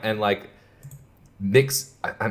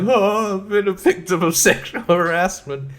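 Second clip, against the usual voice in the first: mock victim voice; 8 semitones higher than usual. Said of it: "the male reporter's like".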